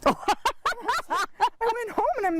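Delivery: laughing through the words, high and slow. Real, not acted. Telling the team about pub nights, laughing. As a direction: let the laugh spill into the words.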